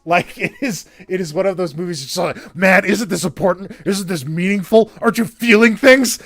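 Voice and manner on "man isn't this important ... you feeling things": mock movie shouting. Host mimics a movie shouting its importance at viewers, mock-earnest shouting. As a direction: badger the audience, louder with each question. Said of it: "shouting into your face".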